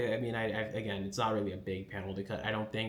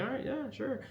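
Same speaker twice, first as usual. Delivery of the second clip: high, mock-indulgent brush-off; voicing Kifri humouring an overconfident Agat, mock dismissive. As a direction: humour her with a high-pitched sure. Kifri brushing off Agat's boast.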